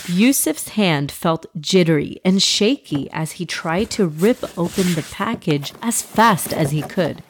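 ordinary delivery, nothing marked

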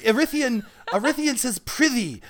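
high-pitched Shakespearean ham; Arithian. Shown as a demonstration. Narrator demonstrating his Shakespeare-obsessed gamer character, high and theatrical. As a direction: pitch it up like an overdramatic high school thespian. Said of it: "Arithian has an overdeveloped sense of Shakespeare".

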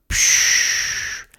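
long voiceless diving-gear hiss; imitated breathing hiss. A narrator's reading. Theobald about to speak underwater, imitated airy hiss. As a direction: let out a long rushing "psch" of air.

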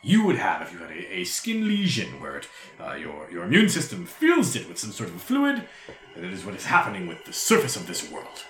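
animated, swooping lecturer-devil voice; mannered gentleman scholar holding forth; courtly devil explorer. Acted. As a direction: explain the expedition with theatrical relish.

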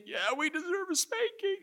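put-on sheepish kid answering dad; meek little boy. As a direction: squeak it like a guilty child.

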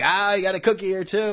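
a hint of a bubbly checkout clerk; chatty cashier. Cashier chatting about cookies.

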